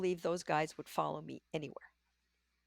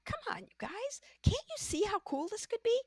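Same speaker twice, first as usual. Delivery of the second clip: much higher, pleading and eager; excited plea; 14 semitones higher than usual. Retold or imitated. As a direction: urge the team on, full of excitement. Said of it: "I'm really excited about this thing".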